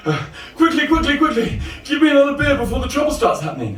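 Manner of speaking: high-pitched urgent plea; urgent, high comic voice; frantic bar customer. Acted. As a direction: rush up to the bar in a panic. Same frantic customer, third order.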